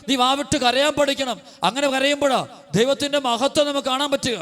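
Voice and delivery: high, impassioned sermon voice; fervent preaching. Acted. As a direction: preach it out with rising fervour. Preacher exhorts at full force. (ഉയർന്ന സ്വരത്തിൽ ആവേശത്തോടെ പ്രസംഗം)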